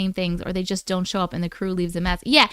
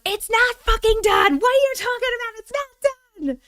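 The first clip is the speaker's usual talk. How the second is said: exasperated outburst; 11 semitones higher than usual. Retold or imitated. Streamer re-enacting kitchen rant, exasperated. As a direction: re-enact a fed-up rant, pitched way up. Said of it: "I was literally in the kitchen going".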